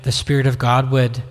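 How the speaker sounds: ordinary talk, nothing marked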